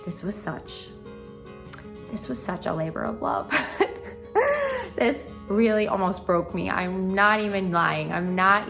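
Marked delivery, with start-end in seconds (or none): 3.6-4.9 laugh. Real, not acted. Laughing about the gallery wall.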